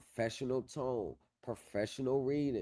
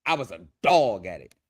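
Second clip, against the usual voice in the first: louder proud claim; loud boast; 4 semitones lower, 10 dB louder than usual. Real, not acted. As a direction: belt out the brag. Bragging about criminal law skill.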